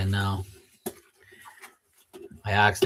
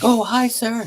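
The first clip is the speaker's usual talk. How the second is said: much higher, sudden sweet greeting; imitating the caught maid; 12 semitones higher, slower than usual. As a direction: squeak it out like someone caught. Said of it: "She's like".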